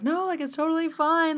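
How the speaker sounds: high-pitched, dismissive reassurance; breezy reassurance. Retold or imitated. Bree brushing off her apology, high reassuring voice. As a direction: wave the apology away in a high voice.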